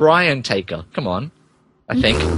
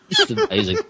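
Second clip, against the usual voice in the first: mock awe; 5 dB quieter, much faster than usual. Real, not acted. Reacting to story absurdity.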